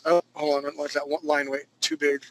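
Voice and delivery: usual speaking voice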